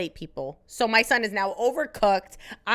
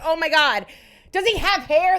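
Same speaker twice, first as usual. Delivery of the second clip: high, loud, retold wonder; amazed excitement; 6 semitones higher, 6 dB louder than usual. Mother watching baby crown, amazed excitement. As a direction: exclaim in thrilled surprise. Seeing baby's head in mirror.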